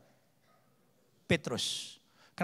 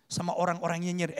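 ordinary delivery

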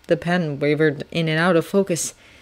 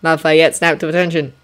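plain reading voice

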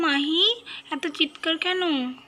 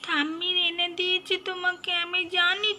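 put-on higher little child's voice, wheedling; pleading child; 5 semitones higher than usual. Child begging her mother to let her mop the floor. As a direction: coax your mum, please please.

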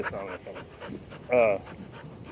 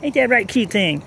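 high, louder doting coo at the dog; 7 semitones higher, 6 dB louder than usual. Real, not acted. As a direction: gush over the puppy in a squeaky voice. Baby talk to the dog, repeated.

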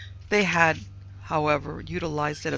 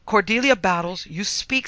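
louder, higher, scolding order; peremptory command; 5 semitones higher, 6 dB louder than usual. A narrator's reading. Mistress shaking the speechless maid, peremptory command. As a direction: order the maid sharply to answer. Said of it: "who could be peremptory".

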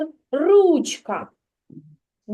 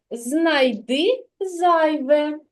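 slow game title; much slower than usual. Shown as a demonstration. Teacher names the game.

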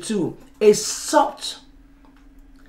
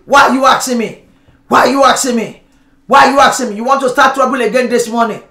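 louder, quick, irritated snapping; with attitude; 3 dB louder, faster than usual. Retold or imitated. Spouse snapping back over toothpaste, irritated and fast. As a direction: pick a fight, sharp and fast. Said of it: "one with attitude".

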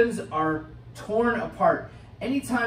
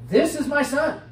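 "This is my son" higher and louder, announced outward; proclaiming loudly; 8 semitones higher, 6 dB louder than usual. Shown as a demonstration. God announcing "This is my son" to the onlookers, proclaimed. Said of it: "said to those around him also".

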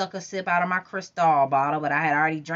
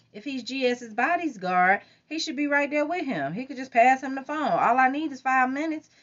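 high pleading voice; 10 semitones higher than usual. Acted. Narrator pleading, pitched up.

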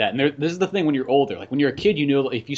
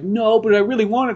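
put-on longing kid; 8 semitones higher, 7 dB louder than usual. Voices wistful kid.

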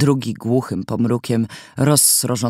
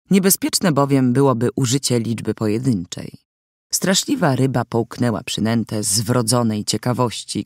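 usual speaking voice

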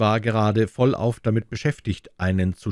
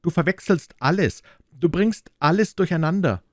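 put-on high, sharp scolding from a small boy; furious reproach; 10 semitones higher than usual. A narrator's reading. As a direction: hurl the accusation upward. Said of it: "Er war echt wütend".

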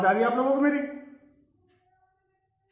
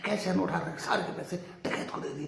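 ordinary delivery

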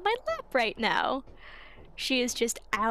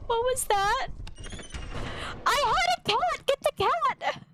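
high shocked outcry; 9 semitones higher than usual. Real, not acted. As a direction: yell in disbelief as the cat is lost. Sudden death in game.

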